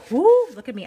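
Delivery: loud self-congratulating woo; cheesy excited woo. Real, not acted. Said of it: "that was super cheesy".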